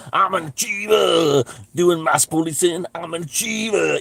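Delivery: a sneering impression of a control freak; mock self-important man. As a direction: strut it out like a pompous jobsworth. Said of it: "this kind of fucking thing".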